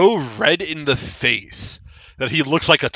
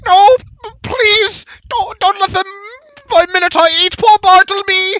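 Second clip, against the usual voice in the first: high, loud, stammering plea; terrified halfling; 7 dB louder than usual. Acted. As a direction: beg for your life in high-pitched terror. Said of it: "looks at you all terrified and goes".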